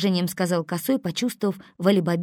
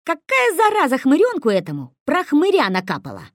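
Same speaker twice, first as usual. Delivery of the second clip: indignant; 9 semitones higher, 7 dB louder than usual. A narrator's reading. Косой indignant.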